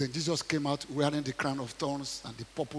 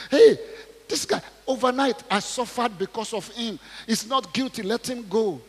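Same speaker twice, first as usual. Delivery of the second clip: pleading wife; 6 semitones higher, 6 dB louder than usual. Acted.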